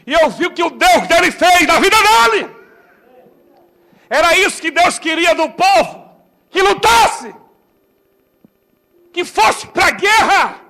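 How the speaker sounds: loud, high preaching cry. Acted. Preacher proclaiming loudly to the church. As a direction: proclaim it to the congregation.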